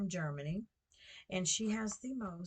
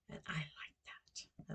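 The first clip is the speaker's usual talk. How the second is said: whispered to herself; 2 semitones lower, 8 dB quieter, slower than usual. Real, not acted. Musing to herself about simple life.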